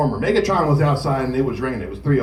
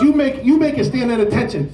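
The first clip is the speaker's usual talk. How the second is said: drill chief bark; 7 semitones higher, 4 dB louder than usual. Retold or imitated. Drill chief barking order.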